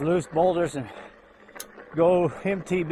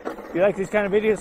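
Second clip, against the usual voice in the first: normal talking voice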